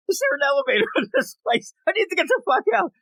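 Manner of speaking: high, rushed comic complaint; mock panic. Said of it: "She's like".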